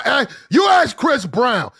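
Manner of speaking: all-out shouted comeback; screaming retort. Shown as a demonstration. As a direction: scream it right in her face. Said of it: "get right in her face and start screaming".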